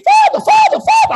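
put-on high, breathless kid calling Father; boy bursting in to call the priest; excited altar boy. Altar server runs in to the priest, shrill calling.

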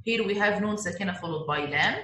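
normal talking voice

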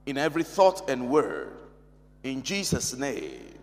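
low, measured prayer with pauses between phrases. Real, not acted. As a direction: lead the congregation in an opening prayer. Opens the session in prayer.

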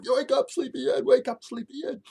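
very high, loud niece voice; imitated child yelling. Niece yells at sleeping moose, high-pitched shout. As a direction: shriek it like an excited little kid. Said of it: "my little niece is like".

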